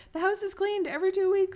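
high, loud boastful announcement; mock shout. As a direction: shout it to the world. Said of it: "shout it".

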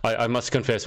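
normal talking voice

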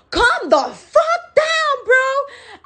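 very high, drawn-out exasperated plea; indignant retort. Retold or imitated. Recounting her comeback to the people yelling, indignant retort. Retelling own retort to the yellers.

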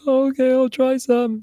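high small voice, reluctant; father imitating his son; child giving in. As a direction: mimic the son agreeing. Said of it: "And he was like".

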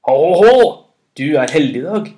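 loud, jovial god's call; booming call. A narrator's reading. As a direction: crow it out loudly, then ease slightly. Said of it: "ropte Thor".

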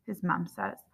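plain reading voice

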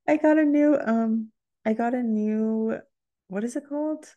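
high, laughing squeals of real joy; giddy laughter, voice way up; overjoyed, near tears. Real, not acted. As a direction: gush over the new review, close to crying. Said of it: "oh, I'm gonna cry".

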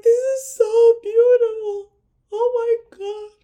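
high, loud, tearful gushing; mock-crying at the proposal; gushing teary voice. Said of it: "I just was like".

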